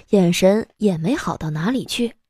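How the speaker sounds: ordinary talk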